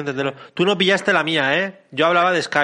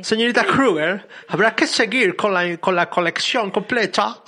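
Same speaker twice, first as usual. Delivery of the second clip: put-on higher, slow, laughing English accent, as if holding a teacup; hammed-up posh English accent; 5 semitones higher, slower than usual. Host overdoes it as co-hosts ask for more English, hammed-up English accent. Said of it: "más, más inglés, inglés con una copa".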